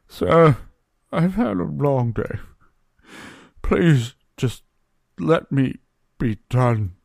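loud, slow, worn-out plea from a tired barman; weary bartender. Acted. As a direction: beg to be left alone after a long day. Bartender Barry begs to be left alone.

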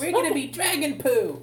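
high, slow cartoonish wail; silly high cry. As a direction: squeal it like a cartoon.

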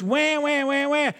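imitated whining, rising high; mock baby wail.

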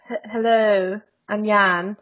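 bad mock-posh British accent; posh impression of Jan. Co-host impersonating her British podcast partner, mock-posh accent. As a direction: tease him with an overly posh British greeting. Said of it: "I do not sound that posh at all".

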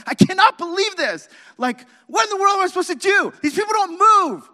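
very high, very loud ranting scream; furious screaming. Retold or imitated. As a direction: lose it completely behind the wheel. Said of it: "yelling and screaming".

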